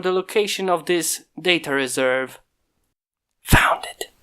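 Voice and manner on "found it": breathy unvoiced whisper; hushed whisper to himself. A narrator's reading. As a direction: whisper it, barely there. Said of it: "whispered the creator".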